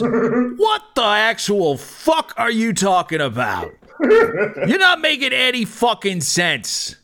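high-pitched, incredulous bouncer voice; hammed-up exasperation; exasperated bouncer. Acted bouncer's exasperated outburst.

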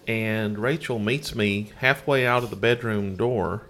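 ordinary talk, nothing marked

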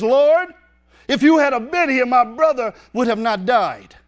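Martha's grieving outburst, loud and high; hot upset Martha. Acted. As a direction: Lord shouted high and loud, hurt and accusing. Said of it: "I don't think she said it in that tone".

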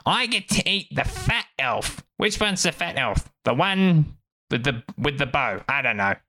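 high-pitched squabbling goblin voice; bickering goblin. Acted. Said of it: "bunch of goblins that are all, like, bickering".

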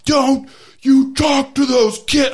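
hammed-up furious mama bear shout; Mama Bear yell. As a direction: roar it like a mother protecting kids. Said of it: "my wife explodes like Mama Bear".

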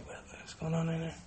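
very quiet, barely audible aside; hushed mock eavesdropper; imitating eavesdropping child. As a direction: murmur it like a kid secretly listening in. Child eavesdropping on parents' conversation.

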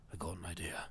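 whispered low movie imitation; hushed, deep mock murmur; quiet quote. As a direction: whisper it low, quoting the film. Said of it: "just gets all quiet and goes".

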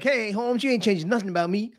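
a hint of a street hustler; tough street voice. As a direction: sass the teacher like a gangster kid. Essay talking back to teacher.

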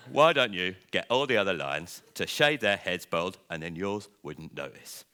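the full crow suggestion, laughing at the end; crow's voice, retake. Acted. Crow telling Lion to get all lions shaved, in a lightly voiced crow. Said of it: "A crow landed on Lion's nose".

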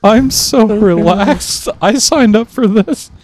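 sarcastic. Pretend. Sarcastic about busy schedule.

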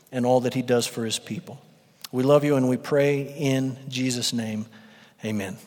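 soft, reverent opening prayer. Acted. Pastor praying over his church before the sermon, soft and reverent. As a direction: pray quietly over the congregation.

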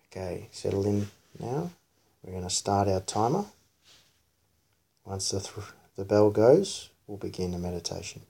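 soft, quiet meditation voice; hushed, soothing body-scan guidance; guided meditation. Real, not acted. Leads child's guided meditation.

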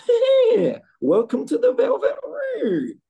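high, loud, stretched-out character voice; Igor. Acted. Podcaster showing co-hosts how Igor used to sound, high hammed-up impression. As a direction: put on a bad high-pitched old Igor accent. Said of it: "I'm gonna do a terrible accent".

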